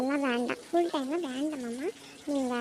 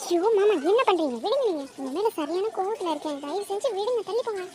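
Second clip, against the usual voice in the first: angry protest; 6 semitones higher than usual. Acted. Wife to husband who grabs her, high angry protest. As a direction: push him off, still cross.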